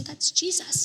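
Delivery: unvoiced hushed whisper. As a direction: breathe it out secretly. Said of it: "Then I heard a whisper".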